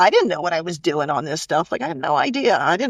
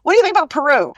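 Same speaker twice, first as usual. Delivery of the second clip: calling out high, a bit louder, to a passing colleague; retold yell across the office; 9 semitones higher, 3 dB louder, faster than usual. Calling out to a colleague walking past, mildly raised retold yell. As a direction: shout her name down the hall, half-real. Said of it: "I just yelled out".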